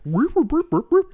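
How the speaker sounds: high, loud burps of a rewinding tape; rewinding tape noise. Retold or imitated. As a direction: chirp burp burp like a tape rewinding. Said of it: "human, uh, interpretation of a V C R player".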